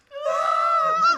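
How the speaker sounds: sharp high loud gasp of shock. Retold or imitated. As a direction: gasp as you spot your lost child. Mother finds lost child.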